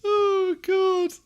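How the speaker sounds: high squeal through laughter; helpless laughter. Real, not acted. Streamer overcome by their own Starburst curry joke, helpless laughing. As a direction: gasp it out mid laughing fit, very high.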